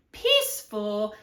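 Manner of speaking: usual speaking voice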